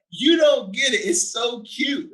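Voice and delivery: high, loud, delighted outburst; gushing shout. Retold or imitated. Father telling daughter she's too cute, delighted shout.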